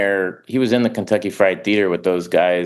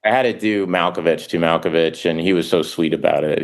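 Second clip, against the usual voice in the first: ordinary delivery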